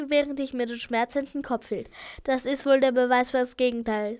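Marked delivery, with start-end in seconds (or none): none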